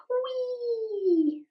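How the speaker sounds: a high whispered whee; playful airy whee while blending; breathy whee. Real, not acted. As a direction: let out a tiny giddy whee under your breath.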